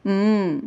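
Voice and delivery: "mm" hummed as one held sound; letter sound M. Shown as a demonstration. Teacher showing pupils the beginning sound, "mm" hummed. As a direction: hold "mm" with closed lips. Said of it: "इसका beginning sound है".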